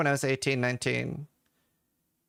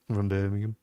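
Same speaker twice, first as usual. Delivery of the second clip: put-on deep Brummie accent; 7 semitones lower, faster than usual. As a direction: lower Birmingham accent.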